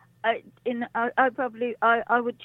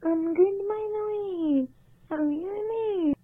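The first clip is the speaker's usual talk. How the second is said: mock Scottish voice; 11 semitones higher, 5 dB quieter, slower than usual.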